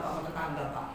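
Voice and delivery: ordinary talk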